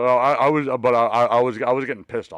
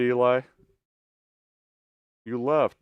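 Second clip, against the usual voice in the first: ordinary talk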